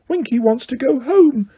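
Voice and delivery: high, small elf voice; Dobby defending Winky, high elf voice; house elf voice. A narrator's reading. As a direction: squeak it like a loyal house elf. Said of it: "Dobby explaining to Harry".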